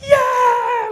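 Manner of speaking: huge, high shouted cheer; crowd cheer. Retold or imitated. Imitating the neighbours roaring when the Springboks won, a full high shout. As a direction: belt it out like a stadium.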